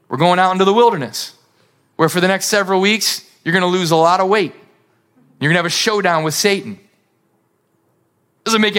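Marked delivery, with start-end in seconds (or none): 0.1-6.8 fast, matter-of-fact fatherly reply; father breezily listing hardships, fast; brisk father. Acted. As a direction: rattle off the plan cheerfully. Father explains plan briskly.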